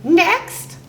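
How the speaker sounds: high, rising parrot call. A narrator's reading. Parrot calls the next rascal, high rising squawk. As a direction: pipe it up like a parrot. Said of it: "said the parrot".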